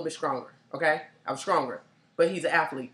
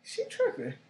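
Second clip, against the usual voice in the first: lightly mocking boyfriend; 7 dB quieter, slower than usual. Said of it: "he was like".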